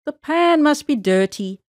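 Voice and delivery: usual speaking voice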